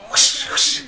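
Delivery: loud breathy whoosh of the hose; whoosh sound. Retold or imitated. Mimicking the hose blasting water, loud whoosh.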